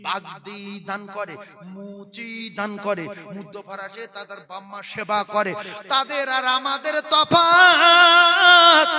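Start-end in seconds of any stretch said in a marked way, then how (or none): 5.9-8.9 2 loud, slow, chanted sermon climax; 3 ring it out over the crowd, drawing out each word; 1 fiery preaching. Real, not acted. 4 preacher pleading with the young men, loud and slow.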